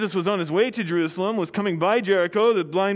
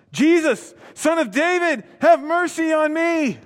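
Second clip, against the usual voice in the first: loud, high cry of "have mercy on me"; calling out; 9 semitones higher, 5 dB louder than usual. Acted. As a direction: call out to Jesus from the roadside, high and loud. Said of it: "He called out".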